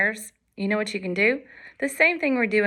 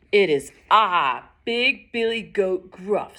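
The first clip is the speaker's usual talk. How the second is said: slow, steady big-goat voice; big fearless goat; 3 dB louder, slower than usual. Acted. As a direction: announce yourself slowly and firmly. Big fearless goat answers troll.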